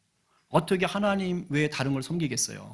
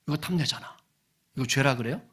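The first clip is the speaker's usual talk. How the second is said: ordinary talk, nothing marked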